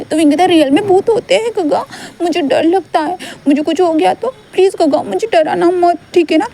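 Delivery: put-on high, anxious little-boy voice; a small boy afraid of ghosts; scared child. Wing begs Gaga not to scare him, high frightened child voice.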